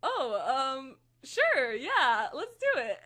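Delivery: loud, high, breezy yes with a laugh; celebrity casually agreeing to the interview; mock casual agreement. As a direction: play him as easygoing but say it big. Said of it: "And he was like".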